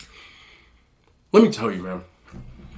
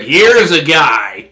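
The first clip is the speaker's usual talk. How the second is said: slow, louder broadcaster drawl on "Here is a guy"; Chris Collinsworth impression; 2 semitones higher, 6 dB louder, slower than usual. Imitating the announcer for viewers, slow and louder. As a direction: stretch it like a football color commentator. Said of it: "he's always like".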